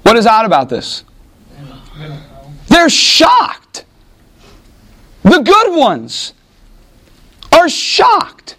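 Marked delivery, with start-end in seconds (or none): 2.7-8.5 shocked. Acted. Preacher acting out shocked disbelief.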